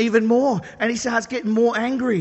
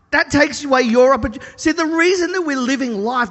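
ordinary talk, nothing marked

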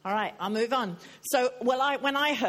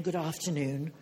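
mock-solemn introduction, dropping low; mock self-announcement; 4 semitones lower than usual. Preacher imitating Jesus announcing himself to strangers, mock-solemn. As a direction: announce yourself with hammed-up gravity, slowing down.

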